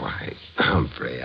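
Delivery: slow whispered greeting. Acted. Templar sneaking up on the butler Humphrey, slow whisper. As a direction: breathe his name, drawn out.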